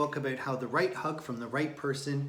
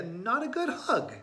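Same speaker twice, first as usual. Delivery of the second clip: big sister, disappointed; 9 semitones higher, 3 dB louder than usual. A narrator's reading. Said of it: "said Thea".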